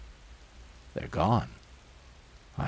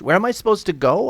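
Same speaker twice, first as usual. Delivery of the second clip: higher, louder, bewildered demand; alarmed protest; 8 semitones higher, 6 dB louder, faster than usual. Acted. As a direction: demand an explanation in rising alarm. Resident protests being evicted.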